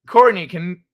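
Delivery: ordinary delivery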